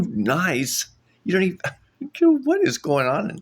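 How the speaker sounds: high, loud incredulous laugh-talk; laughing disbelief. Retold or imitated. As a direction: laugh through the outrage.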